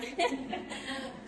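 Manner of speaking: brief real chuckle; laugh. Real, not acted. Speaker to congregation after admitting confusion, laughing. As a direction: laugh lightly at your own aside. Laughs at own aside mid-testimony.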